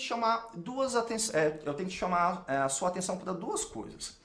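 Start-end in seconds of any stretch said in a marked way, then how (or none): none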